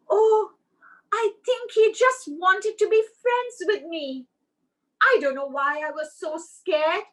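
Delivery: high, delighted squirrel voice; a relieved squirrel bursting with joy; happy little squirrel. Acted.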